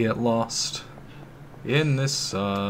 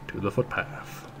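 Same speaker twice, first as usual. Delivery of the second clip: whispered aside, much softer; whispered to himself; 3 semitones lower, 8 dB quieter than usual. Real, not acted. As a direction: breathe it to yourself. Repeats then whispers.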